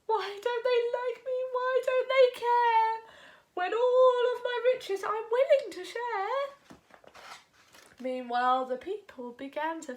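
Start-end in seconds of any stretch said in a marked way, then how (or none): 0.0-6.5 high, whimpering goat, a touch of tears; miserable whimper. A narrator's reading. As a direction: plead as if about to cry. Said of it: "the goat whimpered miserably into his beard".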